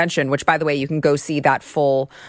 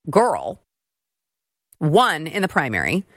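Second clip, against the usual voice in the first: ordinary talk, nothing marked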